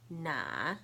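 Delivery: "na" dipping low, then rising; third tone. Shown as a demonstration. Teacher showing the four tones, "na" dipping. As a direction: let "na" sink and climb back up. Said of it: "I'll say the four tones".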